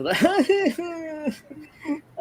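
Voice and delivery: high imitated laugh; mock cackle. Host imitating her laugh, high cackle. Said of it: "All she does is laugh".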